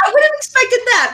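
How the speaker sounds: sarcastic, high and loud; sarcastic jab at herself. Real, not acted. Mocking self, sharp jump.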